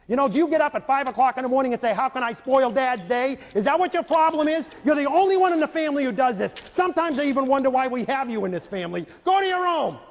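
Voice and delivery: loud, high scolding rant; furious father berating his son; angry yelling dad. Retold or imitated. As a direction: blow up at the kid at the dinner table. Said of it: "Yelling at him in front of the family".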